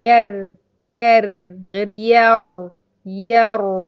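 ير said heavy then light, slow and loud; slow ra contrast drill. Shown as a demonstration. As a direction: set the heavy ير against the thin ير. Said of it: "شايفة الفرق؟".

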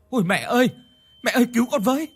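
high, loud cry for help; terrified scream. A narrator's reading. Child calling mother to save them, terrified scream. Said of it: "Tôi sợ hãi hét lên".

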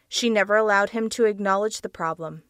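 plain reading voice

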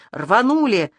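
usual speaking voice

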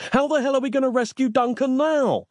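high, exasperated wail; very high, indignant protest; crying out. A narrator's reading. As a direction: demand it in frantic dismay. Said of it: "he cried".

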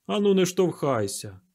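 moderately furious order; angry bark. A narrator's reading. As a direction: bark at a pushy crowd. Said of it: "розлючено гаркнув помічник заступника скарбника".

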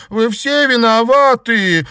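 high, loud, drawn-out yell of blame; furious scream. A narrator's reading. Enraged girl blaming all her schoolmates, furious scream. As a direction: scream the accusation at all of them. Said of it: "закричала Наташа".

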